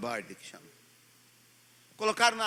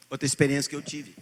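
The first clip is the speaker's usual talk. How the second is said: usual speaking voice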